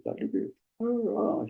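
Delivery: high, wavering mock sob of a young dentist; imitating crying. Former resident tearfully calling her old teacher, imitated sob. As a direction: voice her tearful call for help, mildly. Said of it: "she was crying".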